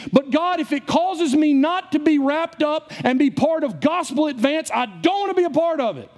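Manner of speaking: high, ringing pulpit delivery; fervent preacher, stretching the key phrases; impassioned preaching. Real, not acted. Preaches at full force.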